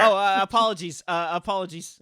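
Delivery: high, loud, hurried-off urchin; acted nervous grovelling; flustered apology. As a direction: stammer apologies as a scolded street kid. Scolded urchin apologizing before fleeing.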